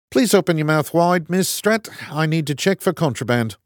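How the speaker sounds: put-on broad kiwi accent, firm; stern kiwi prison guard; New Zealand accent. A narrator's reading. Guard mocks Strat.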